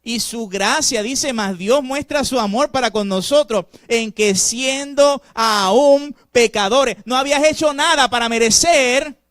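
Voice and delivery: loud, slow, ringing sermon voice; fervent preaching. Acted. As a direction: preach it out to the whole church. Preaching at full force. (predicando con fuerza)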